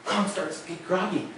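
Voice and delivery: ordinary delivery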